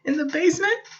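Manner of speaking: put-on high, unsure little boy; a nervous boy asking; timid child. Laszlo asking the dark where, high and timid.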